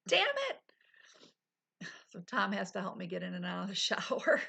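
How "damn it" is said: frustrated curse. Retold or imitated. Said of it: "It's like".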